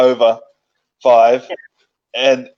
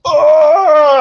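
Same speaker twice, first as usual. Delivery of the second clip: retold scream; 7 dB louder than usual. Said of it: "you didn't hear me screaming".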